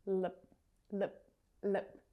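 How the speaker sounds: short, weak Lip with an uh vowel, repeated; isolated little Lip syllables, vowel reduced to schwa. Shown as a demonstration. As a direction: say each lip as an unstressed lup. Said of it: "reduces down to the schwa".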